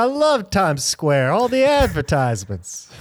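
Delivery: high, loud mock New York accent, gushing; hammed-up New Yorker raving about Times Square. Mocking New Yorkers loving their city, New York accent. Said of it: "my New York accent's getting way worse".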